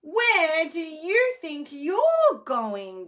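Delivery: loud, slow, high troll voice; demanding troll. Acted. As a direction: bellow it like a big troll. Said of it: "demanded the troll".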